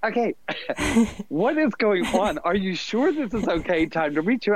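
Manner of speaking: a recruiter losing the thread, pitched well up; imitating puzzled recruiter. Recruiter on the phone, baffled by the narrator's sarcastic answers. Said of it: "she goes".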